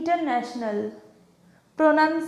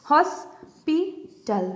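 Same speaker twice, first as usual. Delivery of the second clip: "Hospital" slowly in three separated parts; syllable by syllable; 3 semitones higher, much slower than usual. Shown as a demonstration. Teacher pointing out the three parts, syllable by syllable. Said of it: "হসপিটালটাকে যখন ভাগ করেছি".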